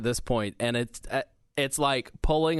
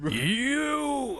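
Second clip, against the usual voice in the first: Macho Man; 11 semitones higher than usual. Pretend. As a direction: bellow it like a wrestling promo. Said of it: "I'm going full Macho Man".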